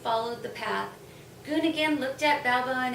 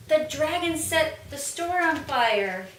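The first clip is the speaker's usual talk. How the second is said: a touch higher, raised alarm cry; mild scream; 5 semitones higher, 5 dB louder than usual. A narrator's reading. A local crying out that the dragon burned the store. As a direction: shout the alarm half-heartedly. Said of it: "One of the locals screamed".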